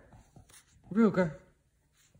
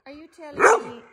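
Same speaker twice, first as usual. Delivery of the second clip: normal talking voice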